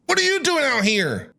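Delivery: ordinary talk, nothing marked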